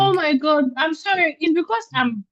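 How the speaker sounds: startled, high and quick apology; flustered. Real, not acted. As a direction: caught off guard, apologize in a rush. Caught not listening, flustered apology.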